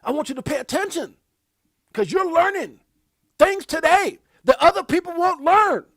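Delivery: a father earnestly urging his son to pay attention; voicing a dad. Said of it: "his dad is trying to say".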